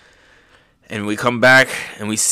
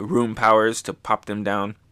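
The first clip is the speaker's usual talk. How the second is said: normal talking voice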